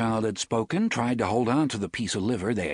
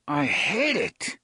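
through clenched teeth; 6 semitones higher, slower than usual. Acted. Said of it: "said through clenched teeth".

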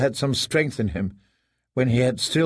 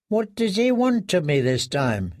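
higher, hopeless inner voice; despairing prisoner; 6 semitones higher than usual. A narrator's reading. Prisoner dreading his master, higher and despairing. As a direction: ask it with no hope left.

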